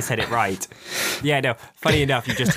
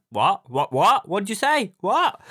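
mock confused assistant, each what rising higher; confused Siri; 7 semitones higher, slower than usual. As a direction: Siri that keeps failing to hear. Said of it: "my Siri is like".

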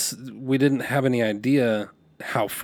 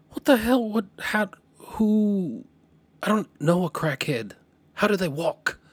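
high-pitched, incredulous questions; retold bewilderment, voice jumping up; baffled disbelief; 8 semitones higher than usual. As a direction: sputter questions as if utterly lost. Retelling his own baffled reaction.

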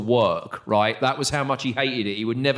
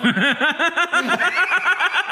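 high burst of laughter. Real, not acted. Host cracks up at a rock star's put-down, high laughter. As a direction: crack up at the punchline.

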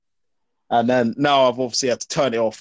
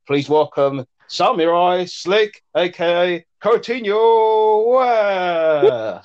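slow, high, drawn-out showman announcement; announcer voice; 6 semitones higher, 3 dB louder, much slower than usual. Pretend. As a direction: announce him like a boxing ring MC. Introducing guest, showman style.

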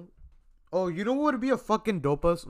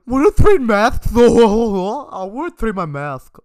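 put-on loud, slow, silly child voice; goofy kid voice; 5 semitones higher, 15 dB louder, slower than usual. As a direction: ham up a little kid. Goofy loud kindergarten-kid bit.

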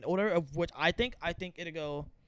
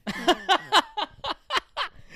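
loud laugh; 8 dB louder than usual. Real, not acted. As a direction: burst of high laughter. Joke about [name] lands.

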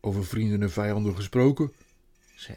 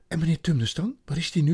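put-on higher, louder child asking; eager child; 6 semitones higher, 5 dB louder, faster than usual. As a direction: ask it urgently. Child asks urgently.

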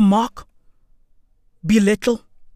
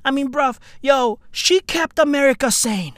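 ordinary talk, nothing marked

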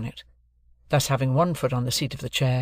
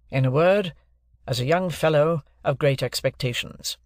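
raised, deliberate lawyer's voice; stern lawyer; 3 semitones higher than usual. Acted. Jaggers introducing himself to Joe and Pip, stern lawyer. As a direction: announce yourself like a cool London lawyer.

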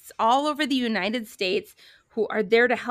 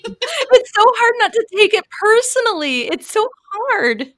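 laughing, higher and louder; 10 semitones higher, 10 dB louder than usual. Real, not acted. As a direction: laugh through the words in sympathy. Host laughs at the story.

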